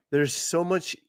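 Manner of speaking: normal talking voice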